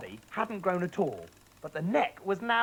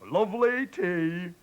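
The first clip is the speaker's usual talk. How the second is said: slow, sly sweet offer; gloating slow murmur; 2 semitones higher, 4 dB louder, slower than usual. Acted. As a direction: tempt her, knowing better. Mister Cranky slyly urging Grandma.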